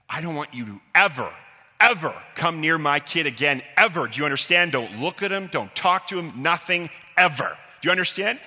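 a father laying down the law to a bully; stern warning. Retold or imitated. Dad threatens bully.

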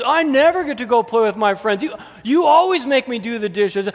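high, loud whiny outburst; exploding kid. Acted. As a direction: blow up at mom like a frustrated kid. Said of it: "you can totally explode".

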